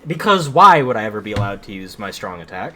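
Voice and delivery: exasperated. Real, not acted. Complaining about enemy immunity.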